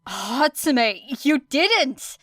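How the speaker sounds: rising to an indignant cry on didn't; outraged protest. Acted. Era realizes the missing harness.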